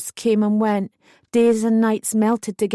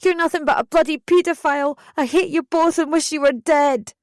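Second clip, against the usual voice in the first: yelling; 6 semitones higher than usual. A narrator's reading. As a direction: spit the hatred at them at full voice. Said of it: "I yelled back".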